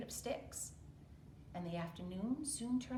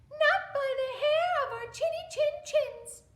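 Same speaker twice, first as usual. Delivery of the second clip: much higher, louder little pig; squeaky defiant pig; 11 dB louder than usual. Acted. Little pig defying the wolf through the door, high and squeaky. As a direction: squeak out the refusal.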